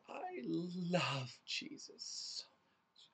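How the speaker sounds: slow, sickly-sweet whispered imitation; syrupy awful voice. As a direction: breathe it out like a cloyingly devout fan. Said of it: "in the most syrupy, awful voice".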